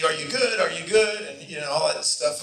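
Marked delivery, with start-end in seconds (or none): 0.0-1.2 a louder, half-shouted "are you good"; mock yelling at a co-star; retold shouting. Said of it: "we're shouting at each other".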